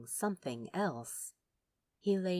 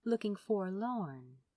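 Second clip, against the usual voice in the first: usual speaking voice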